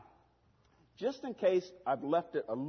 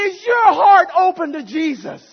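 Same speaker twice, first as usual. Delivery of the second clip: shouted question; 18 dB louder than usual. Acted. Preacher shouting the question at the suitor. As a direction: shout it out loud and high. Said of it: "Ask him".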